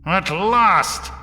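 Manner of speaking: high, loud cry of triumph; triumphant outburst. A narrator's reading. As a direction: exult at the long-awaited moment. Voris finally gets his countdown.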